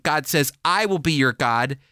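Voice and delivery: ordinary delivery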